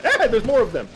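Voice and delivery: high, loud startled cry; alarmed outburst. Real, not acted. Gamer startled by another wave of enemies, alarmed cry.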